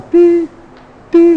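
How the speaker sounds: imitando o bipe agudo de UTI; bipe de monitor. Retold or imitated. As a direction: faz o pi-pi do aparelho. (imitação aguda e forte do bipe do monitor)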